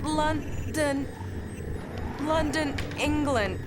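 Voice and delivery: a mind-controlled officer confessing to his captor; entranced. Acted. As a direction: give it up as if hypnotized against your will. Lieutenant answers under mind control.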